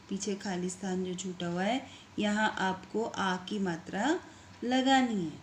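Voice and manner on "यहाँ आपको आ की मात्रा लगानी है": ordinary delivery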